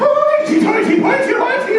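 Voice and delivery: high, giggling mock moan; mock Irish lover. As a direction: squeal it like a giddy Irishman mid-romp. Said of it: "he's like".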